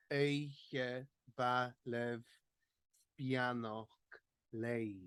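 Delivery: "Eiche va lev" drawn out very slowly with stress on "Eiche"; slow stress demo. Shown as a demonstration. Teacher replaying the line slowly for the class. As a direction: spell out the stress at half speed. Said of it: "we've switched around the stress".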